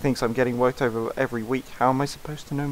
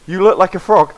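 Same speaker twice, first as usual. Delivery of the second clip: mocking insult; 5 semitones higher, 10 dB louder than usual. Insulting character in game.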